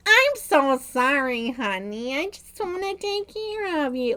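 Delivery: high, drawn-out, syrupy mother voice; hammed-up coddling mom; mock doting mom. The mom coos to her fragile grown son, overly sweet.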